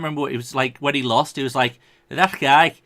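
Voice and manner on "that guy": loud Scouse comedian impression; punchy mock Scouse accent. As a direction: imitate a very Scouse fighter. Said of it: "a comedian that's very Scouse".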